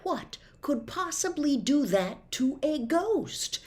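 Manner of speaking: ordinary delivery, nothing marked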